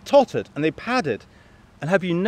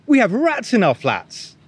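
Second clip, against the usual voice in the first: excited; 9 semitones higher, 4 dB louder than usual. A narrator's reading. Bunny telling excited news.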